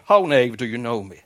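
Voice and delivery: a burst on the opening word, then settle; exclaimed. A narrator's reading. Outlaw challenges the crucified man who knows him, mild exclamation. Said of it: "exclaimed the other".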